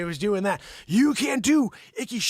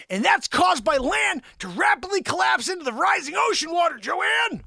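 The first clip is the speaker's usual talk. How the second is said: high-pitched exasperated shout; screaming at her; 8 semitones higher, 4 dB louder than usual. Acted. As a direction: yell it right at her. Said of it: "unless you scream at her".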